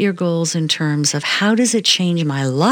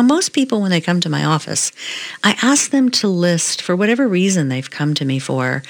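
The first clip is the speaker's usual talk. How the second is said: normal talking voice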